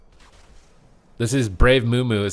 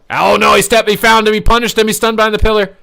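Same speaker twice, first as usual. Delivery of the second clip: higher, louder rapid call; excited shout; 10 semitones higher, 8 dB louder than usual. Real, not acted. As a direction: call the play as it explodes. Sudden punish on the paladin.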